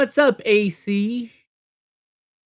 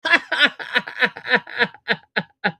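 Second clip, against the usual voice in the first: real laugh; 8 semitones higher than usual. Real, not acted. Laughing at the joke clip.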